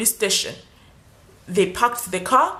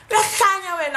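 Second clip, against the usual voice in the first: shouted start, then raised mock abuse; mock insult; 8 semitones higher than usual. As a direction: spit it out as the rude cop. Rude cop insulting again.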